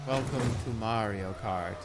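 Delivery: soft, slow, bored mumble; low energy. Shown as a demonstration. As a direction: say it like you can't be bothered. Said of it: "super low effort and very low energy".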